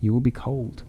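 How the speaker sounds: soft, gentle coaxing; tender. Acted. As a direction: softly coax her back to bed. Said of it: "he said tenderly".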